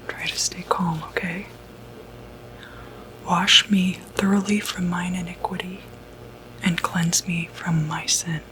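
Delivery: ordinary delivery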